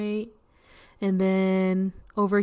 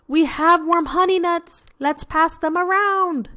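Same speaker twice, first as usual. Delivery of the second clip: higher, louder happy animal voices; cheerful critters; 8 semitones higher, 6 dB louder than usual. Acted. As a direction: offer the treats brightly like eager little diggers. Gopher and Mo offer nuts.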